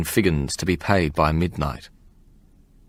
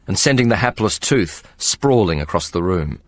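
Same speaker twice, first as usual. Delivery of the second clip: ordinary delivery, nothing marked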